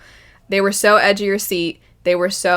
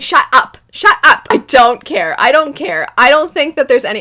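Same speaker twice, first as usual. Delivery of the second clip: louder, higher furious "shut up"; higher, louder dismissive rant; angry shouting; 5 semitones higher, 7 dB louder than usual. Retold or imitated. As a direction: tell him off, loud and fed up. Retold rant at the villain.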